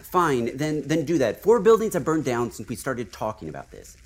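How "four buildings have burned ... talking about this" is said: ordinary talk, nothing marked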